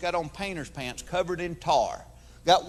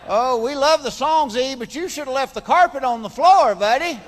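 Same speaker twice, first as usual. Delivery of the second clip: fan talking to [name]; 5 semitones higher, 7 dB louder than usual. Retold or imitated. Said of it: "had the thought".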